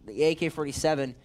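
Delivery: quick play-by-play call, lifted pitch; esports caster. Acted. Live play-by-play.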